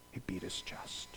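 a breathy whisper, almost gone. Real, not acted. Preacher whispering the tax collector's act. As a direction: hush right down on it.

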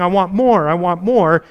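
loud, insistent craving, repeated; greedy flesh voice. Retold or imitated. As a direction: demand it like a greedy appetite. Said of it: "my flesh rising up and saying".

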